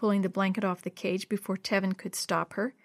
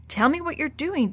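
higher-pitched urgent demand, not whispered; mild panic; 6 semitones higher, 3 dB louder than usual. A narrator's reading. Dee Dee racing after Jenny, mildly panicked. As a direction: press for an answer with a touch of panic. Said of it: "said in a panicked whisper".

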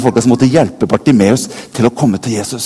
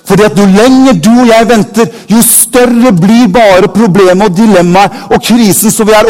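raised, urgent preaching voice, slowed on the middle line; fervent preaching; 6 semitones higher, 4 dB louder than usual. Acted. Preacher urging the congregation not to wait, with fervor. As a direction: press the warning home to the church.

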